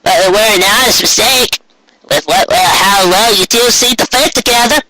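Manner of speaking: a chirpy country grandma; old Granny Smith voice. Acted. Granny Smith teasing couple.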